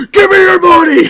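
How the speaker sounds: high, very loud screeching demand; mock granny robbery shout; grandma turns mugger. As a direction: shriek it like a granny mugging you. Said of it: "And she's like".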